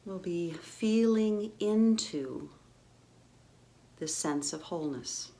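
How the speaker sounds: calm meditation voice, long pauses between phrases; guided meditation. Real, not acted. Guide settles listeners into their physical bodies, calm meditative. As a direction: gently lead them into the body, unhurried.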